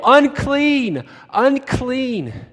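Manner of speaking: loud, high cry of Unclean, Unclean; loud leper's yell. Retold or imitated. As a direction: shout Unclean as you enter the crowd. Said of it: "immediately yell".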